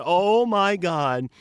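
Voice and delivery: high, loud exclamation of dismay; drawn-out, high "oh my God" realizing a big mistake; retold dismay. Retelling his shocked discovery about a character.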